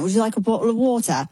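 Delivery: quick imitation of his British accent. Concerned musician offering pregnant fan water, imitated British accent. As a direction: put on a British accent, brisk and solicitous. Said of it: "in his accent".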